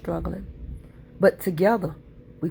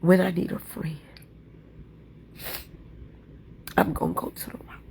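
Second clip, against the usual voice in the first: whispered hymn line; 4 dB quieter than usual. Acted. Speaker reciting the hymn to viewers, whispering. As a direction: sink the last line to a whisper.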